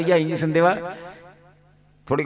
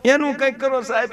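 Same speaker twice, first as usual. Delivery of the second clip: higher, softer pleading wife voice; 7 semitones higher, 3 dB quieter than usual. Retold or imitated. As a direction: beg the doctor in a higher voice.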